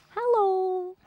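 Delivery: high, sing-song hello; cooing greeting. Real, not acted. Player greeting a cute animal, cooing hello.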